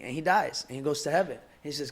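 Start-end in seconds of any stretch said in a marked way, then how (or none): none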